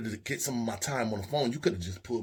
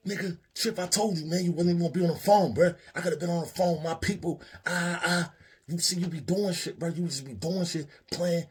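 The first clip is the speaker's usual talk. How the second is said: high, loud, fast complaining rant; angry yelling; 8 semitones higher, 5 dB louder than usual. Retold or imitated. Man in the bathroom yelling at Chip, angry. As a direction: chew him out for hogging the phone.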